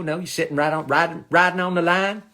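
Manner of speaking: normal talking voice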